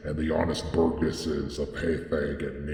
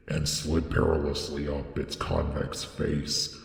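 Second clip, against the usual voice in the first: plain reading voice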